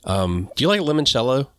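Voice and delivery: ordinary delivery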